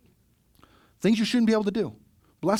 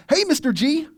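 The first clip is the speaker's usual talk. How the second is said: put-on high, sunny kid hello before the switch; cheerful kid greeting; 10 semitones higher, 4 dB louder than usual. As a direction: chirp it like nothing is wrong. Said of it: "Luke just went from".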